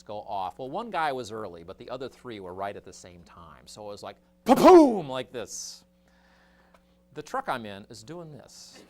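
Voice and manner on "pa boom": loud, high explosive boom; cannon boom imitation. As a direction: blast it out like artillery.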